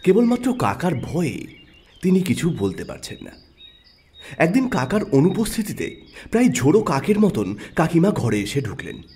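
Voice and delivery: usual speaking voice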